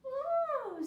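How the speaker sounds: high squeal of delight; a thrilled little cry; child's excited oh; delighted gasp. A narrator's reading. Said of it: "said Kiko".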